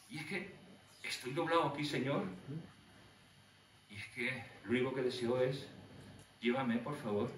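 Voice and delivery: barely holding on, asking to be taken; weary, defeated plea. Pretend. Preacher voicing a broken prisoner, softly begging. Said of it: "Yo diría".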